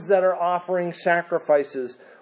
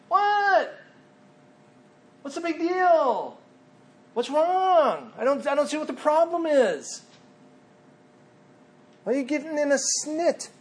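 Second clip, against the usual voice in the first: high, fast, whiny put-on child voice; defiant child; 11 semitones higher than usual. Preacher voicing scolded child talking back to parent, whiny. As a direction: sulk back like a kid who's been caught. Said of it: "they get angry at you".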